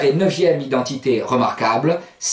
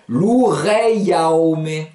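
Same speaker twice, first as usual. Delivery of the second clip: "loureiaome" very slow, each vowel group drawn out; slow, sound by sound. Shown as a demonstration. Teacher joins two vowel groups, very slow "loureiaome". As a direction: stretch "loureiaome" as lou-rei-ao-me. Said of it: "les prononcer l'une à côté de l'autre".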